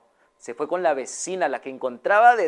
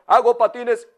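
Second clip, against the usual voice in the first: vendor; 6 semitones higher, 5 dB louder than usual. Acted. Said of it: "Y el señor".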